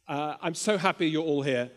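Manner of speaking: usual speaking voice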